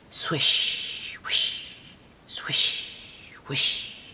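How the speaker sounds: whispered brushing sounds; soft sound effect; whispered swish. A narrator's reading. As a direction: breathe out each swish.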